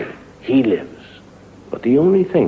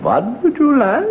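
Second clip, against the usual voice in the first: innkeeper voice; 5 dB louder than usual. Acted. Innkeeper asks the traveler for his order, high odd voice. Innkeeper serves a third time.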